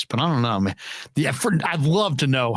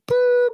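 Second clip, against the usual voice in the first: very high "boop" tone; imitated machine beep; 4 dB louder than usual. Voicemail starting the next message, high imitated beep. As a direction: mimic an answering machine's tone. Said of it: "And then it's like".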